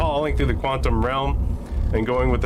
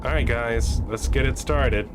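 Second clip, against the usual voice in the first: ordinary talk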